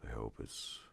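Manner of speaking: very soft, low ASMR murmur with long pauses. Real, not acted. Streamer musing to viewers about ASMR, hushed ASMR voice. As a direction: speak very quietly and unhurriedly, as if close to the mic. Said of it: "I'm already doing it right now".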